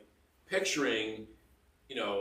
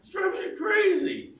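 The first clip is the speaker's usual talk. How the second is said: exasperated; 8 dB louder than usual. Real, not acted. Exasperated complaint about forgotten phone.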